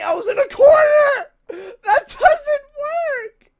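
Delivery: terrified high yell; screaming in real fright; panicked scream. Real, not acted. Jumpscare in horror game.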